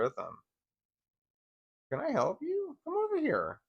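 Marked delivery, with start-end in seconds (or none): 1.9-3.6 very high, sing-song coo to a pet; squeaky pet-talk voice calling the dog over. Real, not acted. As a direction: coo up high like talking to a puppy. Cooing to the dog.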